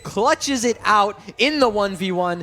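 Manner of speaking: rising hype caster call; esports caster. Acted. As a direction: build from calm to shouting. Play-by-play build to clutch.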